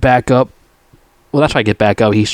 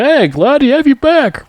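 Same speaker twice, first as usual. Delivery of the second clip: much higher put-on greeting voice; mock cheery greeting. The despised officer welcoming Rambo back, mock cheery. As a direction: mimic a fake-friendly welcome, pitched way up.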